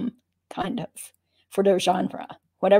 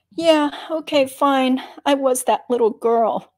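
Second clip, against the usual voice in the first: louder, higher, exasperated give-in; exasperated admission; 5 semitones higher, 6 dB louder than usual. A narrator's reading. Narrator caving in to the footnote, exasperated and loud. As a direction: throw up your hands and admit it.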